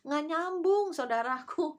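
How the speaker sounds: high, loud "it doesn't add up"; exasperated exclaim. Real, not acted. Preacher to congregation, exasperated high exclaim. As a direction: throw up your hands in disbelief.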